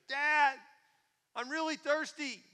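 high, slow, loud plea; kid hollering. Acted. Little boy pestering dad from bed, hollering high and slow. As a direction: stretch it out like an insistent child. Son yells down again.

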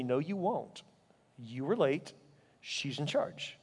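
low, soft inner voice with pauses; quiet correction. Retold or imitated. Holy Spirit gently corrects him.

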